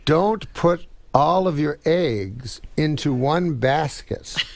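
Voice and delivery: very slow, word by word; solemn moral. Acted. [name] giving the class her moral, slow and deliberate. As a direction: spell out the lesson slowly. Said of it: "Well, the moral is".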